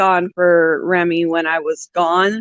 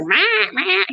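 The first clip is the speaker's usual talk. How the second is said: high little mock squawk; a hint of a cranky parrot; imitating grumpy bird; 12 semitones higher than usual. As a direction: do the bird grumbling. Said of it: "And then he's like".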